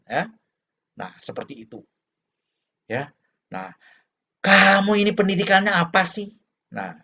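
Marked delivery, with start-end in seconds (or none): 4.4-6.3 loud, higher-pitched reproach; open scolding. Acted. Parent questioning child's education to their face, scolding. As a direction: scold the child outright, voice raised. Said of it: "kadang dia ngomong begitu".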